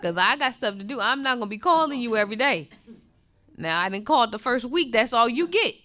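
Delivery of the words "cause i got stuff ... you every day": fast, rushed, dismissive friend; hurried friend brushing off a mourner; impatient friend. Retold or imitated. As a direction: rattle it off like you have somewhere to be.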